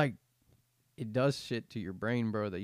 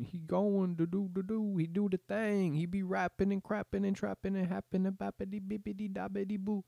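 mock rapper; 9 semitones higher than usual. Mock rapper voice, mocking music.

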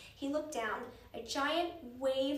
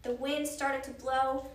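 ordinary delivery, nothing marked

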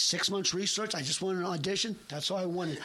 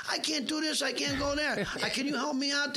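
higher, begging mob-scene plea; pleading restaurant owner; 8 semitones higher than usual. Retold or imitated. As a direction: beg the mob guy for help, pitched up. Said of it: "where he says".

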